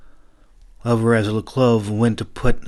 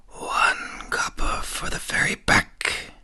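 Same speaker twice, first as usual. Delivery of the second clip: slow, high breathy whisper; slow eerie ferryman whisper; eerie boatman whisper; slower than usual. A narrator's reading. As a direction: let it drift out like a ghostly echo. Boatman voiced, eerie repeat.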